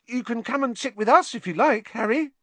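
high, loud keen invitation; excited, over-keen young admirer; eager fan. A narrator's reading. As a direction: offer it with piping eagerness. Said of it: "Colin looked eagerly around".

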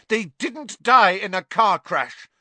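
put-on high, loud furious boy; angry Harry. A narrator's reading. Harry defending his parents, high and loud. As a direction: fire it back at her. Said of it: "said Harry".

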